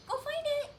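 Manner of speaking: high, bright go find it; high excited send-off cue. Shown as a demonstration. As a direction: sing it out to send the puppy. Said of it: "so you can see that with her".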